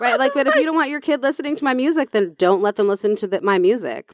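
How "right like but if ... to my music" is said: talking through a high laugh, quickly. Real, not acted. Co-host laughing at Britney's babysitter comeback, laughing fast. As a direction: giggles while blurting the words out fast.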